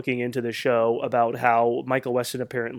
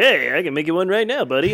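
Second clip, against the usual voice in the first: lightly acted chummy buddy, louder; Sam Axe; 4 semitones higher, 5 dB louder than usual. Co-host plays Sam offering a drink, lightly voiced chummy pal. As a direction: offer it like an eager pal, pitched up. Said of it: "he's like".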